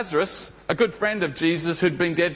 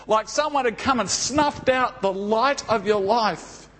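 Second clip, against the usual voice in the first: normal talking voice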